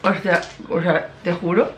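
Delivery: muffled behind fingers; talking with candy-stuck teeth; hand over mouth. Real, not acted. Seen 1: Fingers cover mouth while talking until 2.2; candy in other hand.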